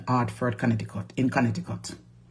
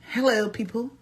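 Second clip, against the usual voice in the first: high, haughty diva voice; diva star; 10 semitones higher than usual. Acted. To her live viewers, a high haughty diva boast. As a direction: preen like a Facebook movie star.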